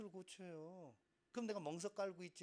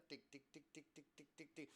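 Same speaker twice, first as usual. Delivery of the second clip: soft, rapid ticking; quiet mechanical tick-tick, quicker; quickened dot beeps; 13 dB quieter than usual. Shown as a demonstration. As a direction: mimic the computer dot speeding up. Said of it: "갑자기 빨라질 겁니다".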